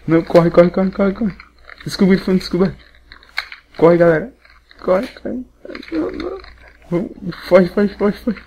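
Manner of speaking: grito agudo e alto de pânico; gritando em pânico. Real, not acted. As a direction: berra pra galera fugir. Fugindo do caçador, em pânico. (correndo, gritando em pânico)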